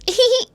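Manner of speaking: short high-pitched giggle; quick rising titter, imitating her; imitated giggle. Recapper showing how the contestant giggled, high giggle. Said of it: "And she does this".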